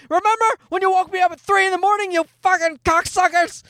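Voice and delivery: hammed-up toddler with a grudge; mock raging kid. Imagined son scolding his parents about early flights, mock rage. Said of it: "pent-up rage from all these trips going".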